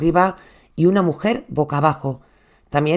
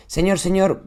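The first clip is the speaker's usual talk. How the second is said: normal talking voice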